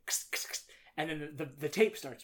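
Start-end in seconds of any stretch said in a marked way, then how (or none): 0.1-0.6 hissed bursts of TV static, voiceless; short voiceless static crackles; imitated static noise. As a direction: imitate a crackling tape glitch. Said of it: "then it's like".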